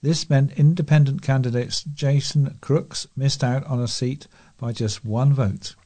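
usual speaking voice

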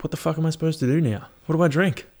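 high, fast, frantic whining; rising mock desperation, quick and high; mock panic. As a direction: flap about the missing drink. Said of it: "I'm like".

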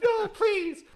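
hammed-up terrified begging; mock pleading lady. Lady begs the thugs in a high, loud falsetto. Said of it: "She's like".

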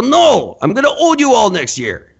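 lightly put-on Chinese accent drifting toward Mexican; mock Chinese accent. Comic answering for people asked if they care, mock Chinese accent. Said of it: "I sounded Mexican while I was doing my Chinese guy impersonation".

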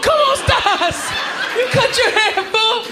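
high, drawn-out holler; mock calling out. Comedian hails a man in the crowd, mock holler. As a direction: call out in a hammed-up high voice. Mock call to Mexican guy.

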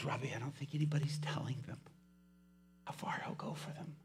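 near-whispered, heartfelt confiding; hushed earnestness. Retold or imitated. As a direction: confide it very softly. Prisoner earnestly confiding in preacher.

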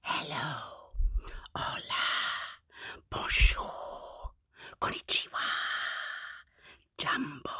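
whispered greetings, spaced out; very soft. Shown as a demonstration. Greeting babies as softly as we can, whispered. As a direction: whisper each hello, drawing out the last syllable. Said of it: "as softly as we can".

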